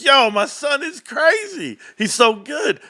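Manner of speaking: high, drawn-out proud exclamation; hyped-up dad bragging on his son; amazed hype. Retold or imitated. Retold amazed reaction to son's play.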